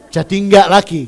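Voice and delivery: normal talking voice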